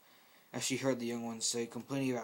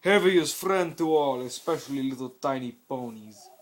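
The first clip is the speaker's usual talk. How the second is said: loud booming voice; 10 dB louder than usual. Acted. Heavy greeting the ponies, loud booming voice. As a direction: boom it out like a gentle giant. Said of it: "said with a loud voice".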